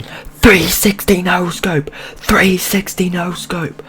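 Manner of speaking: loud high yell, words stretched out; retold shouting. Storyteller replays shouting on a busy road, high drawn-out yell. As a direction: holler it like a wild battle cry. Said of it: "and I was shouting".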